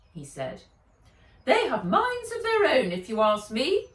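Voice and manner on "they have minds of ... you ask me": loud, much higher grumble; grumpy high complaint; grumbling. A narrator's reading. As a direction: complain loudly in a high character voice. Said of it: "grumbled Fred".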